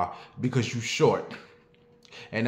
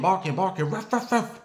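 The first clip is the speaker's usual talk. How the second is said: high yappy ruff ruff; yappy dog imitation; 6 semitones higher than usual. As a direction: yap like a little dog. Imitating a small dog yapping.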